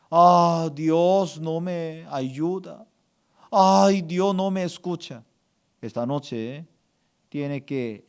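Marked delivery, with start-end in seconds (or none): 0.1-2.8 slow, drawn-out complaint; mock lament. Pastor voices words to eliminate, slow mock lament. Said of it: "Usted tiene que eliminar tres palabras". 3.5-5.2 mock lament. Pastor voices words to eliminate, whining mock lament. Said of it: "Usted tiene que eliminar tres palabras".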